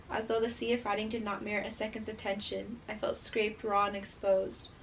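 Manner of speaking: soft, even reading voice; story narration. A narrator's reading. Reader sharing a favourite passage with viewers, hushed narration. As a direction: read it quietly and steadily from the page. Reads book passage aloud.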